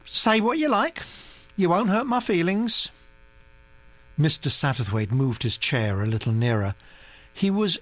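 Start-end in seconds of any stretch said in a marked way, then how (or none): 0.1-2.9 put-on high, brisk aristocratic old lady; old duchess voice. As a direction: gossip like an imperious old duchess. Duchess replies.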